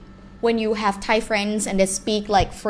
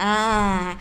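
ordinary talk, nothing marked

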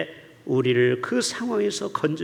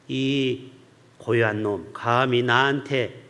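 much lower, slow mock-stern God; 8 semitones lower, slower than usual. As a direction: play God as a scolding master, half in jest. God voiced as scolding.

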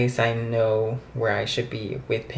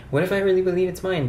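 rushed and higher, "mine" still nasal; quick retake; 7 semitones higher, faster than usual. Shown as a demonstration. Vlogger rushing another take of her affirmation, fast and high. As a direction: blurt it out fast and bright. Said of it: "Um, okay".

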